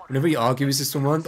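normal talking voice

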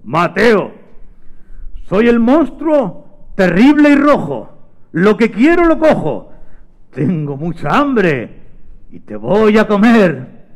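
slow, stretched, menacing monster voice; giant monster. Acted. Monster waking Mateo to eat him, menacing and slow. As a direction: boom it out slowly as a monster. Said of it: "una especie de monstruo gigante".

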